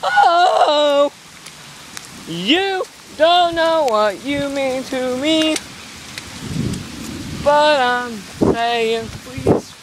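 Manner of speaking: high, slow, hammed-up love plea; mock serenade. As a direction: croon it like a lovesick ballad.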